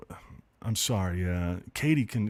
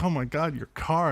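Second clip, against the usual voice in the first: ordinary talk, nothing marked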